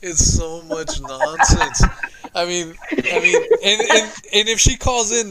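high, loud, through laughter; laughing too hard to get it out; laughing in disbelief. Real, not acted. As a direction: crack up at the absurdity. Both laughing at the nonsense.

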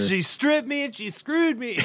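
high put-on voice of a wronged man; hammed-up high complaint; mock wounded husband. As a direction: whine it like a scandalized victim. Mock lament about wife's gift.

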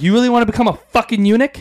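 high, loud, fast threat; threatening the intruder with a shotgun, loud and fast; menacing threat. Retold or imitated. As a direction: snap it out at gunpoint.

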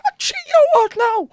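very high, loud falsetto, hammed up; panicked backtracking. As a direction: squeal a mock-panicked refusal. Backing out of African accent request.

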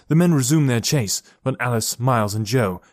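ordinary delivery

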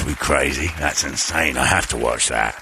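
low, slack aging rocker voice. Acted. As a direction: sink it deep and let the words slump.

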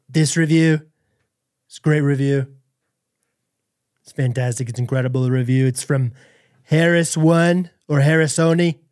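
halting impression of a boastful politician; mock presidential delivery; Donald Trump. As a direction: read it out as the politician, pausing between phrases. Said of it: "do it in a Trump impression".

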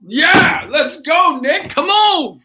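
loud, high hype-up shout; excited cheer. Real, not acted. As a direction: fire him up, let's go. Hyping up the guest.

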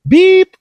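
loud, high-pitched spoken beep; voicemail beep. Acted. Host plays the answering machine, high imitated beep.

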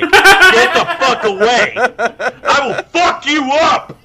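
hammed-up threat while cracking up; laughing yell. Retold telling coworker off.

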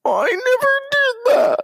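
shrieking, very high strained denial; hammed-up strained howl; mocking Kavanaugh. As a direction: push it out in a near-cry. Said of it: "He sounds like he's trying to take a fucking dump".

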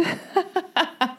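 short high giggle; high laugh. Real, not acted. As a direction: laugh at the silly word. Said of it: "So dumb".

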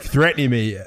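usual speaking voice

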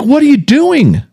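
startled yell. Retold or imitated. Husband waking to his wife at his CPAP, startled high shout. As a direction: wake up shouting in alarm. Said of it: "I'm like".

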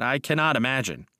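ordinary delivery, nothing marked

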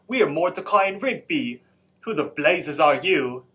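put-on light British accent with a rise on we; a hint of a posh British rocker; British accent, lightly done. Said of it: "who has a British accent".

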